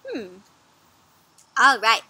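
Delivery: plain reading voice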